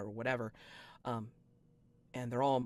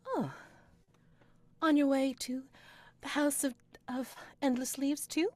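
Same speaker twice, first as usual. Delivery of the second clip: high, sweet greeting from a glamorous lady; bright noble lady; 13 semitones higher, 5 dB louder than usual. Acted. Noble lady greeting the travelling party, high and sweet. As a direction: chirp it like a dazzling young noblewoman.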